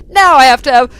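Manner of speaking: hammed-up high, loud wail; mock anguish. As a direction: melodramatic fake crying, stretching the last word. Repeats mock lament after interruption.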